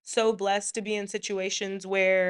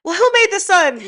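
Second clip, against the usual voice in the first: imitated child voice; 9 semitones higher, 11 dB louder than usual. Son keeps questioning mom.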